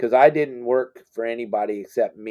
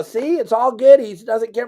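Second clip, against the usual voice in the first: much higher and louder; reassuring; 10 semitones higher, 6 dB louder than usual. Retold or imitated. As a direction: show him it's no big deal. Dad hugging star, reassuring son.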